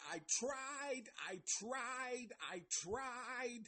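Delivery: high-pitched mocking whine; bitch mode. Reviewer mocking Mike's walkout from the show, bitch mode. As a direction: whine it high, in hammed-up protest. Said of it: "goes into bitch mode".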